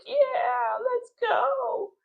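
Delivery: put-on high, squealing kids' cheer; hammed-up excited yell of kids.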